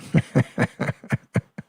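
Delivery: amused run of short chuckles; real soft, breathy laugh. Real, not acted. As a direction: chuckle in quick soft pulses. Amused by chat comment.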